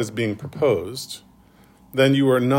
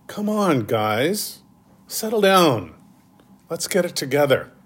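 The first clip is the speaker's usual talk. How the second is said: put-on high, weary coach telling kids to settle; a worn-out gym teacher wrangling kids; tired gym teacher; 5 semitones higher than usual. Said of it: "[name] might sound a bit like a tired gym teacher".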